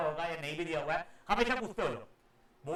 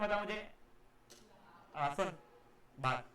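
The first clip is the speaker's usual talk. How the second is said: ordinary talk, nothing marked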